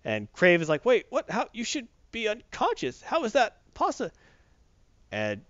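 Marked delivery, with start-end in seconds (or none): 0.8-4.1 mock-shocked villain, pitched much higher; stunned disbelief. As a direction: play Kraven baffled that Spidey stood up. Kraven stunned Spidey got up.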